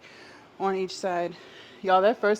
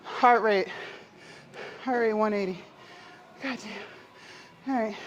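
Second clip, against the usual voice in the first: out of breath; 3 semitones higher, slower than usual. Real, not acted. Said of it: "Let me catch my breath".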